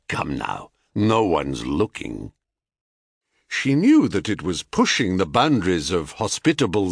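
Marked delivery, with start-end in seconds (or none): 0.1-2.3 low, coaxing Thracian seducer; Thracian accent. A narrator's reading. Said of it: "in a thick Thracian accent".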